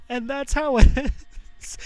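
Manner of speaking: laughing in distress; broken laugh. Real, not acted. Finishing the girl's story, a broken laugh.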